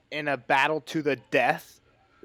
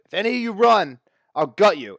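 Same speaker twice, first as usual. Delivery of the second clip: raised-voice mock threat; acted threat; 4 semitones higher, 6 dB louder than usual. Bennis warning the worried villagers, mock threatening. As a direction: threaten them, high and loud at the start.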